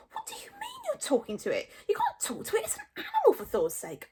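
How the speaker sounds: very high, squeaky disbelief; aghast and squeaking; astonished Fishlegs. A narrator's reading. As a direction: protest in a high-pitched, incredulous squeal. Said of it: "gasped Fishlegs in astonishment".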